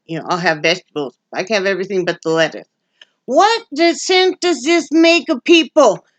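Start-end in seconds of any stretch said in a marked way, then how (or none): 3.3-6.1 hammed-up Italian accent, pitched up; put-on Italian accent. Talking dinner plans to her partner in a mock Italian accent. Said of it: "you see the Italian come out on me now".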